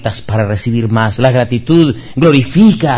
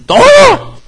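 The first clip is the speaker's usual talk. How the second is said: a sudden high, loud cry of "todo"; exclaimed; 9 dB louder than usual. Real, not acted. Preacher bursting out to the congregation, loud high exclamation. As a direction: shout the word out with joy.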